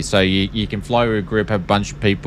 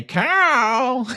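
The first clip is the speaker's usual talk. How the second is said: much higher, sing-song hey Carl; exclamation-mark character voice; high cartoon call. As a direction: toss it out like a cartoon neighbour. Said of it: "and it goes".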